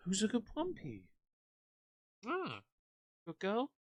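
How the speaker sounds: very high, soft cooing with kisses. Real, not acted. Settling the dog on her lap: high, soft cooing. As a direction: coo at the dog and kiss her.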